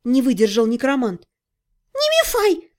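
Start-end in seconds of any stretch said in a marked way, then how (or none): 1.9-2.7 mumbled through a ring in teeth; mouth full. Acted. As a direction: say it with a finger in your mouth. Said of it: "промычала я".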